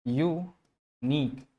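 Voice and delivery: यूनिक stretched very slowly as you-neek; careful pronunciation. Shown as a demonstration. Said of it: "इसका जो pronunciation होता है वो होता है यूनिक".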